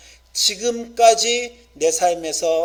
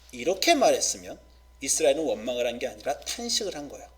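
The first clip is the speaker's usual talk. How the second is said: ordinary talk, nothing marked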